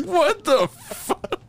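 high, slow, laughing what the fuck; laughing disbelief. Retold or imitated. Retelling his own shock in the car, laughing high. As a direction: squeak it out through laughter. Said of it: "I was like".

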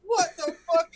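high overwhelmed yell; ecstatic cry. Retold or imitated. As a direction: lose it at the drop. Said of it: "I'm like".

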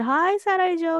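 high-pitched retold hello; retold greeting. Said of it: "I went up to him and I said".

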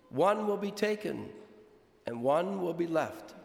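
solemn, measured liturgical reading; gospel reading. Acted. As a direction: proclaim it from the pulpit. Priest proclaims the gospel.

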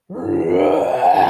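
deep, growling roar on the word; loud bear roar. A narrator's reading. Mummy Bear hears it from the sitting room, deep roar. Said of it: "she heard a loud".

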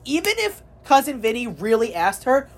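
ordinary talk

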